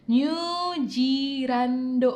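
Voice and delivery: New said stretched, Zealand as jirando, very slowly; Japanese pronunciation. Shown as a demonstration. Teacher demonstrating the Japanese New Zealand very slowly. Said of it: "dia ngomongnya gini".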